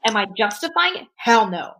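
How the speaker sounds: ordinary delivery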